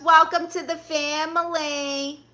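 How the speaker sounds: high, slow, drawn-out sing-song welcome; celebratory welcome. Real, not acted. Streamer welcomes a new subscriber, celebratory welcome.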